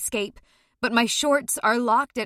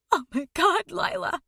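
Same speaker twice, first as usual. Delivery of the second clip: higher, breaking voice; voice catching; 8 semitones higher than usual. A narrator's reading. Mother whispering her daughter's name, voice catching. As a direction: let it catch in the throat. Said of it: "her voice catching".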